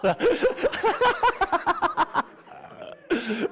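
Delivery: big high burst of laughter; loud high laughter. Real, not acted. Preacher laughing at his own joke, loud laughter. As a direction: crack up loudly.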